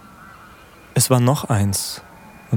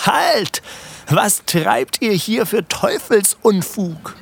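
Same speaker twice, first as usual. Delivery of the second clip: much higher, slower angry cry; second halt, even higher and fiercer; furious shout; 10 semitones higher, slower than usual. A narrator's reading. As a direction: bellow at the diggers in outrage. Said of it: "schrie er".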